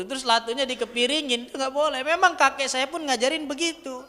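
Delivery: ordinary talk, nothing marked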